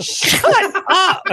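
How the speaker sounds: very high, exaggerated shut up; mock snap. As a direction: toss it off at Russ, hamming up the annoyance. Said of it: "And we were like".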